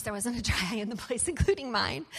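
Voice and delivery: ordinary talk, nothing marked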